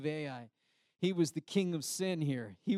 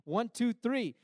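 normal talking voice

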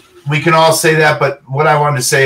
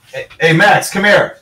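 raised, higher call across the room; 4 semitones higher than usual. Real, not acted. Dad calling his son into the interview, raised call.

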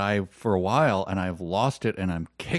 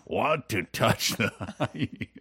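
slow, drawn-out mock line reading; laughing impression of someone reading out a silly line; imitated scriptwriter; 3 dB quieter, slower than usual. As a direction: say it slowly, breaking into laughter. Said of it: "And then he says".

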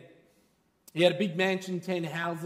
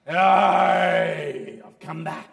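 loud devil; 8 dB louder, much slower than usual. Acted. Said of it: "It's the devil".

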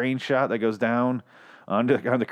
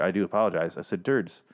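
normal talking voice

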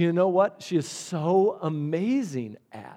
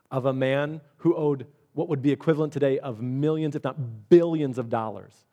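normal talking voice

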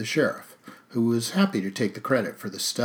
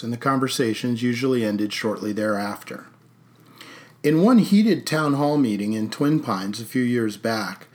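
plain reading voice

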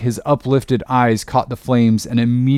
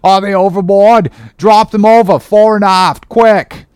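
high, loud order to the crew; urgent shout; 8 semitones higher, 8 dB louder than usual. A narrator's reading. Mate shouting orders to the crew, loud. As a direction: bellow it over the storm. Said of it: "cried Starbuck to the crew".

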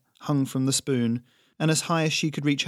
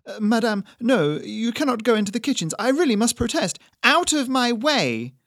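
high, loud, pleading protest; flustered waiter; 11 semitones higher, 6 dB louder than usual. Acted. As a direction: try to stop her politely but failing. Flustered server voiced.

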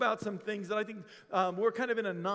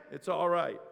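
ordinary talk, nothing marked

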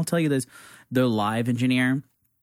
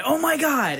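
much higher, starstruck oh my God; excited gasp; 13 semitones higher, slower than usual. Retold or imitated. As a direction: squeal it like you just saw a star. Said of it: "and I said".

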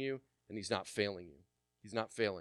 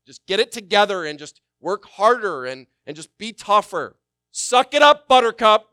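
mock drill-sergeant father, much higher and louder; harsh tough-love father; 10 semitones higher, 16 dB louder than usual. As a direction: bark it like an impatient dad telling a kid to toughen up. Mocking a harsh father's demands.

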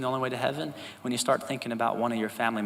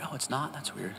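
deadpan aside; 11 dB quieter than usual. Retold or imitated. Mock surprise to street preacher.

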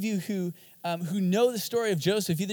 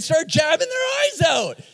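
rising alarm; 10 semitones higher, 6 dB louder than usual. Acted. Kid stabbing eyes with pen.

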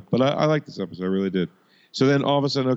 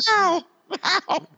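pained yelps; 13 semitones higher than usual. Pretend. Mock cheering at a fight.

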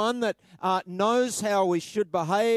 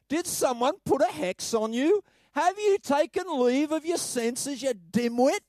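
higher, incredulous scolding questions; raised, slowed disbelief at the foolish church; exasperated scolding; 6 semitones higher than usual. Acted. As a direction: scold them like a fed-up apostle. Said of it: "captures Paul's frustration".

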